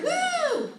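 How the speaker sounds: high, loud "woo" of delight; excited whoop. Retold or imitated. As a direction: whoop like a thrilled customer. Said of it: "she's excited".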